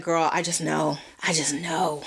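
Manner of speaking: low, understated self-quote; matter-of-fact deadpan reply; knowing certainty. Retold or imitated. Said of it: "And I'm just like".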